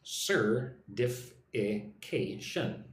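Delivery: Certification stretched out very slowly, syllable by syllable; slow, careful. Shown as a demonstration. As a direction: lay out all five syllables one at a time. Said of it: "look at the word certification".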